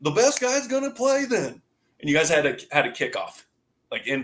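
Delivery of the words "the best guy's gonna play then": angry coach. Retold or imitated. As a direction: play the fed-up coach benching you. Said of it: "He's like".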